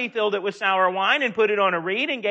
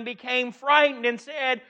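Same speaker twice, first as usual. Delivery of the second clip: usual speaking voice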